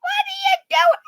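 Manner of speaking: high startled yell; shocked shout. Retold or imitated. Yolanda catching her in the dishwasher, shocked shout. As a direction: shout it in alarm. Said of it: "Yolanda turned around going".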